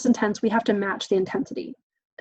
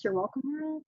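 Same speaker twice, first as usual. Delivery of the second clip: joking aside; 6 semitones higher, 9 dB quieter than usual. Real, not acted. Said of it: "I have kind of a high-pitched voice".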